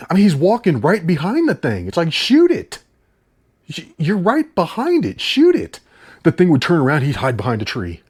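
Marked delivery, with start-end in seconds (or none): 2.1-5.8 mock yell at the screen. Urging the movie hunter, who is right behind the bear, in a high exasperated yell. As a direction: yell at the hero to just shoot.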